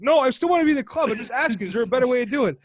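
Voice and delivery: pleading recruit. Retold or imitated. Recruit pleads with the brotherhood, fast and loud. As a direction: rush it out, begging to stay in the club. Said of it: "He's like".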